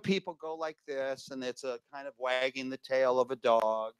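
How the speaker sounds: faint, voice drifting away from the mic. Shown as a demonstration. Host showing the audience bad mic habits, fading off-mic. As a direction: let the voice fade as the mic wanders off. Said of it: "hold your mic on an angle".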